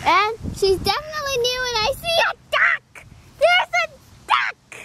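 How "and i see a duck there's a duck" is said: high, thrilled doll voice; high-pitched pretend excitement; excited doll. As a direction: squeal at the sight of a duck. Doll spots a duck.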